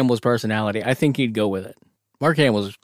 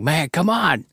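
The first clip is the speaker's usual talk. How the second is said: mock pushy buddy; 6 semitones higher, slower than usual.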